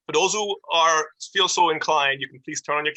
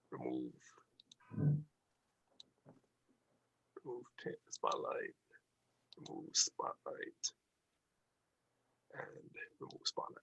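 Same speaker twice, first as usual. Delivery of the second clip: whispering to self; 4 semitones lower, 18 dB quieter than usual. Real, not acted. Muttering while removing spotlights.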